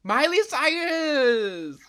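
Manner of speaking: high, loud, slow announcement; excited announcement. Real, not acted. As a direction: proclaim the name with glee. Announcing her topic.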